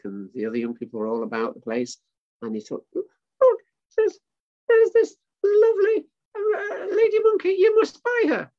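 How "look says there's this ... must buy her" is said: very high, louder puppet squeak; eager monkey. Acted. Monkey urges owner to buy.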